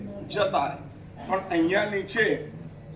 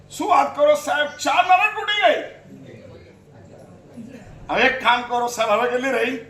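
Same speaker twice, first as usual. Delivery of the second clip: amazed devotee; 4 semitones higher, 5 dB louder than usual. Pretend. Preacher plays a devotee amazed that four hells broke, loud and high. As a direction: marvel aloud like a delighted devotee.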